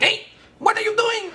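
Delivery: very high, fast, sped-up toy voice; chipmunk-speed talking toy; sped-up cartoon voice. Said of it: "at least five times the speed".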